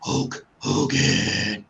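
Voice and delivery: Ultimate Warrior. Retold or imitated. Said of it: "gotta mention the Ultimate Warrior".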